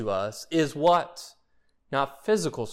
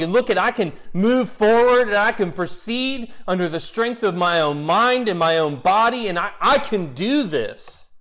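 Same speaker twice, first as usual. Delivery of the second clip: mock boast; 7 semitones higher, 5 dB louder than usual. Imagined boastful Paul quote.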